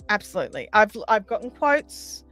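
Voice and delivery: ordinary delivery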